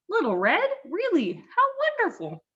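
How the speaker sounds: high, delighted granny; thrilled old lady welcome; old grandmother voice. Acted.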